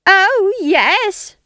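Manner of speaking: high, loud shrieked retort. A narrator's reading. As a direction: let it fly up into a shriek. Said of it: "shrieked Nanny".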